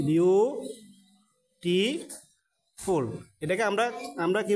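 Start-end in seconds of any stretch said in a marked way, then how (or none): 0.1-3.0 very slow, stretching beautiful across every syllable; teacher stretching the word for pupils; slow drawn out. Shown as a demonstration. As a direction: draw beautiful out slowly, syllable by syllable. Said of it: "কিভাবে উচ্চারণ করি?".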